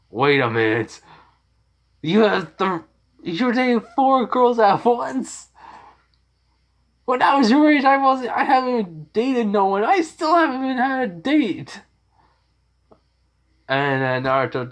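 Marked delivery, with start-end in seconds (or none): 0.1-11.8 put-on high, loud shocked old pervert voice; Jiraiya. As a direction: squeal it high and loud, scandalised. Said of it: "and Jiraiya goes like".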